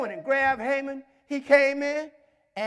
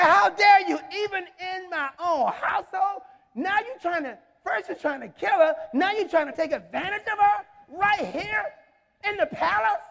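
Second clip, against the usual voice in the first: high-pitched scolding disbelief; rising, affronted questions; indignant king; 5 semitones higher than usual. Acted. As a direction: act a king confronting a betrayer. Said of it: "He was so mad".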